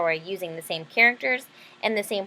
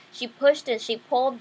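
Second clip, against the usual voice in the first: ordinary delivery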